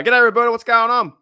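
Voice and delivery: hearty shout-out to a chatter; loud greeting. Real, not acted. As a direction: call out a happy hello to a viewer. Greeting a chat viewer loudly.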